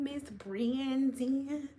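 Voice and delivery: very slow, soft stretched name; playfully drawn-out name. Real, not acted. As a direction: linger softly on each syllable of "Brandon". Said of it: "saying your last name like that".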